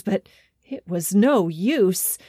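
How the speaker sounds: ordinary delivery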